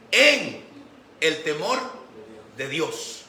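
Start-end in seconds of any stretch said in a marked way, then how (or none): none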